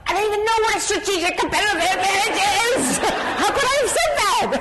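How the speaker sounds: high, fast, laughing disbelief; laughing at your own blunder; laughing through it. Retold or imitated. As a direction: giggle it out in self-mockery. Laughing at own retold thought.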